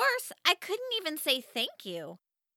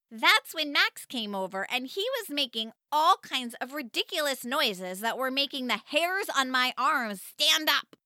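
ordinary delivery, nothing marked

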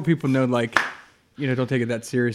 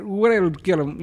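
higher mock Russian with W sounds; over-the-top Russian accent; 5 semitones higher, 4 dB louder than usual. Co-host imitating an actress, over-the-top Russian accent. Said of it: "played it over the top".

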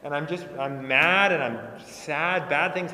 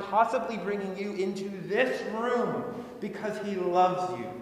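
preaching; 4 semitones higher than usual. Acted. Pastor drives the sermon home to the congregation, rising and fervent. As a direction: lift them up, preaching with full conviction.

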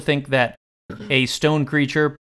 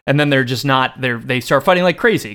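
ordinary talk, nothing marked

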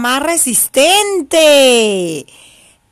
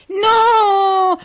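piglets shouting no; 7 semitones higher than usual. Acted. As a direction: fling the no out high. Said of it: "gritaron Tin y Tan".

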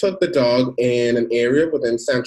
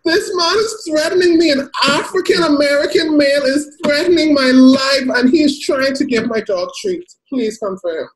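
much higher, louder, hammed-up crying plea; mock panicked call; 11 semitones higher, 4 dB louder than usual. Karen phoning police about the man, mock tearful panic. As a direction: fake terror down the phone line. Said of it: "She picked up the phone looking like this".

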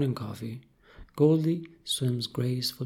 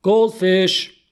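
loud, raised, exasperated call; really annoyed shout; 6 semitones higher, 11 dB louder than usual. Acted. Kat shouting at the ignoring goldfish, really annoyed. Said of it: "Kat gets really annoyed".

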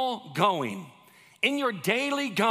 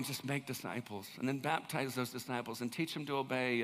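soft, much quieter closing words; much softer; 7 semitones lower, 10 dB quieter than usual. Real, not acted. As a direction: drop to a soft near-hush. Quiet closing point of sermon.

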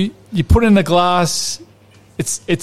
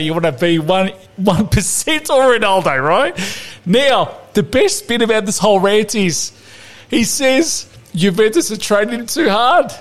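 higher, hammed-up Italian-flavoured tirade; mock outraged rant; 5 semitones higher than usual. Podcast host ranting at Ramsey, hammed-up outrage. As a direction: rant at him like an exasperated nonna.

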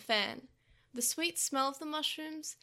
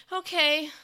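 sobbing okay; 4 semitones higher, 10 dB louder than usual. Acted. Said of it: "heaved with sobs".

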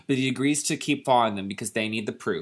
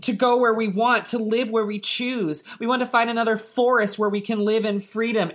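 higher, yearning little gnome voice; pleading gnomes; 7 semitones higher than usual. Acted. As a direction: gnomes begging for freedom, pitched up. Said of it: "And they say".

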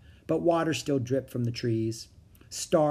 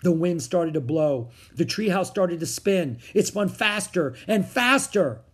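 louder and higher, mounting narration; building excitement; 5 semitones higher, 5 dB louder than usual. A narrator's reading. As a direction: build it up as the spin gathers force. Treehouse spins up in the story.

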